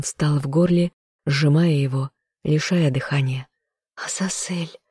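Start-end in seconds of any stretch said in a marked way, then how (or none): 4.0-4.8 whispered, barely voiced name; trembling murmur. A narrator's reading. Heroine overwhelmed by her lover's confession, murmuring in a whisper. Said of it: "пробормотала дрожащими губами".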